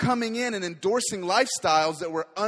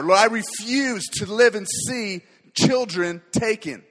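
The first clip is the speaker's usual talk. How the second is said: rising, impassioned prayer that swells louder; fervent prayer; 5 dB louder than usual. Acted. Preacher praying aloud over his church, fervent and building. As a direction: pray over the congregation with growing fire.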